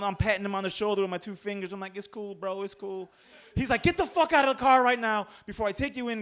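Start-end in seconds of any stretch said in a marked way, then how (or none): 2.0-3.1 soft, raised, soothing voice; calm him with a small high voice; gentle appeasing. Retold or imitated. Drunk passenger patting cop with two fingers, softly placating. 3.8-6.2 loud, high-pitched furious dismissal; angry cop order. Retold or imitated. Cop throwing passenger out of car, angry.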